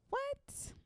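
high squeal. Acted. Catchphrase sung out high.

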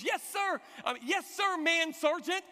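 mock-panicked military shout; panicked recruit. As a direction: imitate a rattled recruit answering. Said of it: "He was panicked. He was freaked out".

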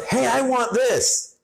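high, bratty demanding mom voice; mom's demand. Retold or imitated. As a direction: mimic a pushy mother, way up high. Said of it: "She goes".

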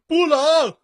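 high, loud shout of no; shouted answer. Retold or imitated. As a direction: shout it out like recruits.

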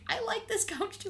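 high cutesy cat voice. Retold or imitated. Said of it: "Just like".

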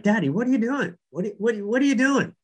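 put-on high, puzzled little girl voice; young daughter. Daughter asking her dad why he turned back, high childlike voice. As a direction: ask like a confused child in the back seat. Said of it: "And Katie's like".

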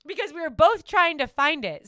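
normal talking voice

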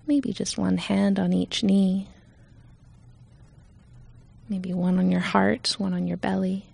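calm, unhurried meditation voice with long pauses; guided meditation. Real, not acted. Leader guiding listeners to settle in, calm meditation voice. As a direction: ease listeners gently into stillness.

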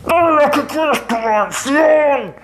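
high, slow shouted urging; savages urging. A narrator's reading. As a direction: egg the brother on.

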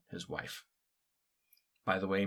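ordinary delivery

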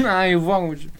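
fast, laughing through the words; laughing quote. Retold or imitated. Host quoting Philip while laughing, laughing mock voice. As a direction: say it while cracking up.

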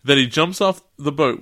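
ordinary delivery

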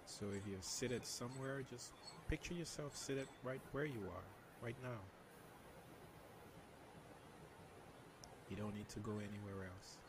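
soft spa whisper. Shown as a demonstration. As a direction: guide them into calm, very softly. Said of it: "can you lead us through a meditation".

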